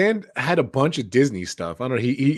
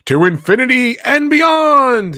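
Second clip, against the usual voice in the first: high, loud, slow heroic cry; hammed-up heroic catchphrase; heroic Buzz catchphrase; 12 semitones higher, 9 dB louder, slower than usual. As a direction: proclaim it like a space ranger. Quoting Buzz Lightyear line.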